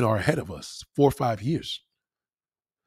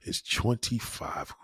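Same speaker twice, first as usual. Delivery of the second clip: muttered aside; 4 semitones lower, 8 dB quieter than usual. Retold or imitated. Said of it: "could look in this damn bedroom and say".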